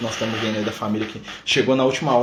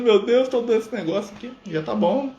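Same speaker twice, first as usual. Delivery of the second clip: mock crying; 8 semitones higher than usual. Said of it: "Antes você ia dar chorando".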